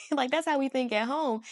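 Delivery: ordinary talk, nothing marked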